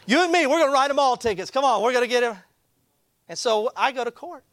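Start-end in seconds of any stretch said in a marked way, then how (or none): 0.1-2.4 high, keyed-up mock call to action; rally a partner for a crusade, pitched up; excited rallying. Imagined rallying of a fellow enforcer.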